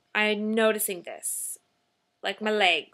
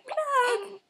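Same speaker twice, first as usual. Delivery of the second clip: put-on high squeaky "Plug!" as the baby; baby saying plug; 12 semitones higher than usual. Mom voicing baby's plug-o-meter call, high. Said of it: "You're just gonna be like".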